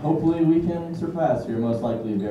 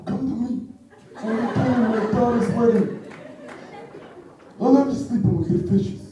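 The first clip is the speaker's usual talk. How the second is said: Godfather impression; 8 semitones higher than usual.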